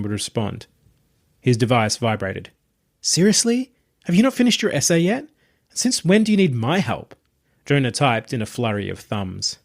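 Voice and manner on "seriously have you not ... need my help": put-on higher, quicker voice for a sardonic young woman; Tabitha's voice. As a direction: sardonic young woman, teasing her friend. Narrator voices Tabitha texting.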